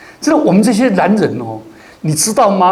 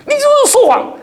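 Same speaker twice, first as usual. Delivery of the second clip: put-on much higher, laughing child's complaint; angry little child. Pastor mimicking a child accusing mum, in a high squeaky voice. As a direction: squeak it like a sulky toddler, half laughing. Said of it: "才四岁多就大骂她的妈妈".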